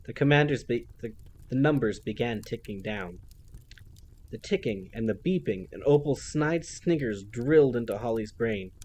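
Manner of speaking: ordinary delivery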